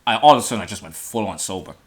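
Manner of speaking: ordinary talk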